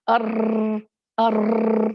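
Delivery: अर्र rolled long and loud like a motorbike; exaggerated trill. Shown as a demonstration. Teacher mocking an exaggerated, endless trill. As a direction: overdo the rolling r. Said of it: "ऐसे नहीं कि हम उसमें मुबालगा करेंगे".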